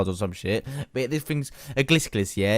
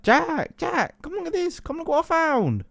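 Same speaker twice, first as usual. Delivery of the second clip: much higher mock excited dad calling. Dad calling son to see his find, much higher and excited. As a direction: call out like a giddy dad. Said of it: "Excitedly, he calls my name".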